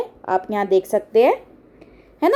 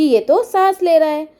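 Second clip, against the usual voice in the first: "ये तो सांस ले रहा है" said louder and higher; raised voice; 5 semitones higher, 3 dB louder than usual. Acted. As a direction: call it out in a raised voice. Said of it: "फिर उसने बोला ऊंचे स्वर में". (ऊंचे स्वर में बोलना)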